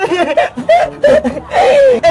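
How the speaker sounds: high, long run of giggles; high-pitched delighted laughter; excited giggle. Acted. Laughing while guessing parcel contents.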